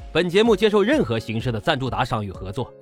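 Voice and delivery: ordinary talk, nothing marked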